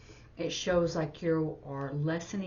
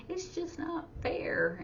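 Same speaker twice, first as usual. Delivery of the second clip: high, slow mock whine; hammed-up mock whine; whiny complaint; 12 semitones higher, slower than usual. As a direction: whine it like a sulky kid. Mocking a complaint.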